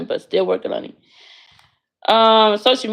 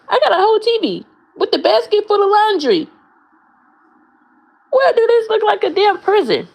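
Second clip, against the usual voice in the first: high, loud, exasperated; outraged disbelief; 12 semitones higher, 3 dB louder than usual. Real, not acted. Answering viewers who call her room a prison, high indignant outrage. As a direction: voice climbing way up in offended outrage.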